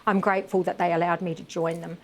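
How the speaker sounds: usual speaking voice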